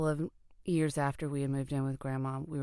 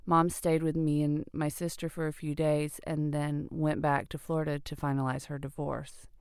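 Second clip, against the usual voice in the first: ordinary delivery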